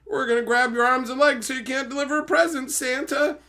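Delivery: high, hammed-up threat from a movie monster; mock villain threat. Gremlins threatening Santa, high hammed-up villain voice. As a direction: ham up a cartoon villain, pitched high. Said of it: "these guys were just like".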